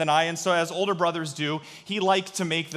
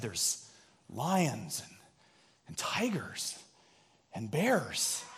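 spooky whisper; 5 semitones lower, 9 dB quieter, much slower than usual. Retold or imitated. Brother scaring him about bears.

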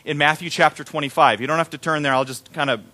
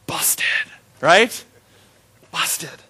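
busted; much slower than usual. Real, not acted. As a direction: murmur it, caught out. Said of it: "caught out".

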